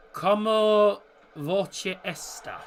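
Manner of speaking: "como voce esta" spoken very slowly, syllable by syllable; careful Portuguese. Shown as a demonstration. Streamer slowly greeting a Portuguese viewer in Portuguese. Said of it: "I am from Portugal".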